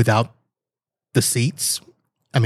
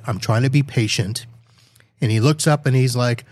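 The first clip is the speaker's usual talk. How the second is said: usual speaking voice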